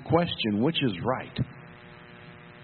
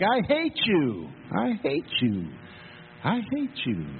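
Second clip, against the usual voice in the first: echoing back; 5 semitones higher than usual. Retold or imitated. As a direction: throw it back like a mountain echo. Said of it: "It echoed back".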